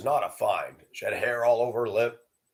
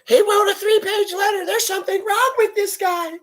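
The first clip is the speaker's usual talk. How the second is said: much higher mock woman's voice, louder; neighbor woman gossiping; 4 dB louder than usual. Neighbor woman telling the street, high mocking voice. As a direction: gossip about him in a high put-on woman's voice. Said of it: "She went across the street and told".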